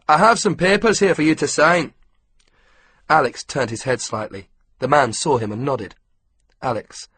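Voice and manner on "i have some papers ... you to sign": put-on Scottish accent. A narrator's reading. Scottish ground worker talking.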